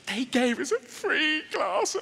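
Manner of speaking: high, slow, hammed-up wonder; mock awestruck customer, stretched out; amazed delight. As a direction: gush slowly over the freebie. Acts delighted customers retelling gift.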